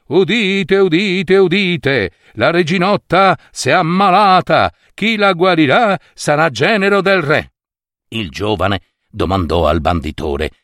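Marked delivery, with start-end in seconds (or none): 0.1-7.5 high calling proclamation; town crier. A narrator's reading. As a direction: cry it out like a herald. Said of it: "andavano gridando".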